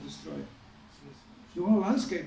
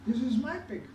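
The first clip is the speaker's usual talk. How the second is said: higher-pitched mock-arrogant retort; imitated haughty brush-off, pitched up; arrogant hunter; 5 semitones higher than usual. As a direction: lightly act a cocky hunter's dismissal. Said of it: "the hunter was laughing arrogantly".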